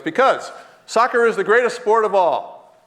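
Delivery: slow, high and loud proclamation; emphatic declaration. Retold or imitated. Visitor tells his host why he asks, emphatic. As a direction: declare it grandly, drawing it out. Child's emphatic claim retold.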